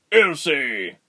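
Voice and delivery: loud, high alarmed shout; father's cry. A narrator's reading. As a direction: cry out the child's name in alarm. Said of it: "her father cried".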